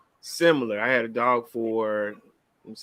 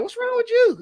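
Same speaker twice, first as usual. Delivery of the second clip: put-on much higher kid's voice, puzzled; nine-year-old son; 4 dB louder than usual. Father imitating his son puzzled at his sad dad, high kid voice. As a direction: squeak it out like a confused little boy. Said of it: "this is a nine-year-old talking".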